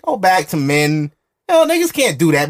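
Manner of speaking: usual speaking voice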